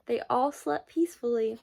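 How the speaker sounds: ordinary delivery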